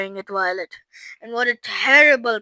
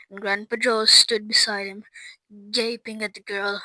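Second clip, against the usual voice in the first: ordinary delivery, nothing marked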